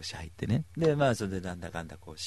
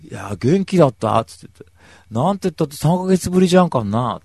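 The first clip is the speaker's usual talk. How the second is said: loud hearty talk; 4 semitones higher, 11 dB louder than usual. Retold or imitated. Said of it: "つって言ってさ".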